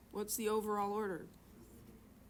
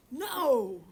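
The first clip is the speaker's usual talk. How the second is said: big, loud, higher-pitched shout; parent's yelled no; 4 semitones higher, 11 dB louder than usual. Shown as a demonstration. Parent of six showing off a favorite word, loud yell. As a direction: holler it like scolding the kids. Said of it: "that's one of my favorite words".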